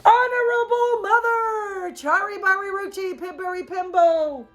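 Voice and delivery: high, loud gasping call; breathless high call. A narrator's reading. Boy gasping the name at mother, high call. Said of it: "he gasped".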